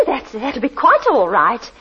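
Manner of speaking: ordinary delivery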